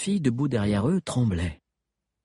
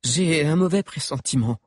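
voix d'outre-tombe; 5 semitones higher than usual. Acted. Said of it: "de sa voix d'outre-tombe".

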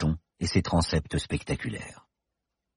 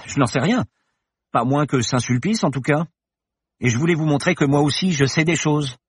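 put-on defiant young woman; 8 semitones higher, 3 dB louder than usual. Paloma defiant.

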